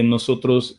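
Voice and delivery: ordinary talk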